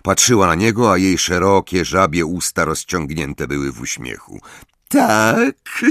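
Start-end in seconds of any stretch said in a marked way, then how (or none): 4.9-5.8 high sugary question; sweetly prying; Umbridge voice. A narrator's reading.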